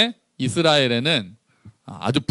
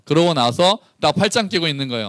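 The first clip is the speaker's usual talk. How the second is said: normal talking voice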